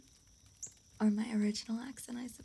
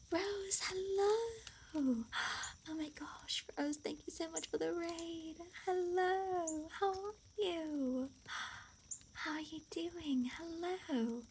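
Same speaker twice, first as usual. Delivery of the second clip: delighted greeting; 6 semitones higher, 3 dB quieter than usual. Real, not acted. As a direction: welcome friends in a high, gushing lilt. Streamer greets an incoming raid.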